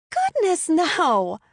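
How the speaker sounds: high, loud mock-shocked cry; over-exaggerated denial. A narrator's reading. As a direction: ham up the shock. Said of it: "she over-exaggerated".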